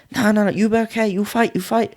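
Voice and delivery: high-pitched mock insistence; Thai trainer. Trainer overruling the holiday excuse, high imitated insistence. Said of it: "He went".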